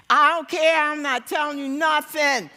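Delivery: sudden loud, high outburst; acted refusal. Mortified wife refuses to tell her husband, acted outburst.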